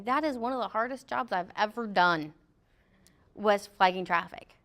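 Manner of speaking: ordinary talk, nothing marked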